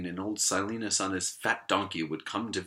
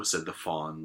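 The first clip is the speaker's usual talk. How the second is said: usual speaking voice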